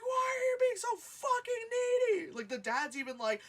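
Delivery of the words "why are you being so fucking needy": much higher, loud yell at the needy cop; retold scream. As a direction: shriek it at the screen, fed up. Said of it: "And I was like".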